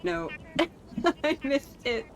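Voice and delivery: normal talking voice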